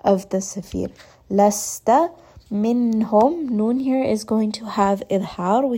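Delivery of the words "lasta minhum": Lasta minhum with a whistling s, drawn out slowly; whistled s in recitation. Shown as a demonstration. Teacher demonstrating whistled s slowly for students. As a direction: let air hiss through the teeth on the s. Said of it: "give it that whistling sound".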